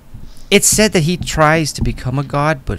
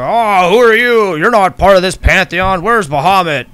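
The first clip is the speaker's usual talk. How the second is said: loud, high, affronted challenge; a god demands to know who the intruder is, indignant; 7 semitones higher, 7 dB louder than usual. Acted.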